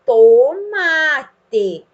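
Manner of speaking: TOMATE loud and drawn out; modelled reading. Shown as a demonstration. Teacher reads the word below with child, TOMATE drawn out loudly. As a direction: stretch TOMATE loudly, syllable by syllable. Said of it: "Vamos ler o que tá escrito".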